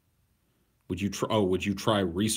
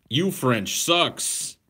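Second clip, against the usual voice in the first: mock critic; 6 semitones higher, 5 dB louder, much slower than usual. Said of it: "quoting a critic".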